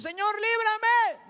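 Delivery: loud, high, drawn-out mock plea; ruego imitado. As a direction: stretch out a pleading shout to God. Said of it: "olvídese de la prueba de decir". (súplica imitada a gritos, alargada)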